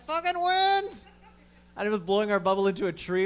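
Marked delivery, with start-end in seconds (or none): 0.1-1.1 high, drawn-out cry of mock outrage at the wind. Stoned friends cursing the wind for threatening their bubble, mock outrage. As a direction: wail at the wind like a betrayed child. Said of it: "We're like".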